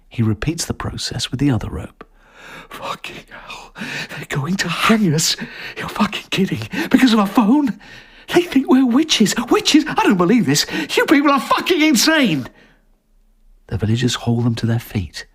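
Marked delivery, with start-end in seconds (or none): 2.7-5.4 breathless, crying whisper; terrified whisper. Acted. Sees the nooses. 5.8-12.5 outraged. Acted. Disbelief at hanging.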